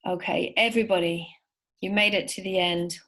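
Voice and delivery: usual speaking voice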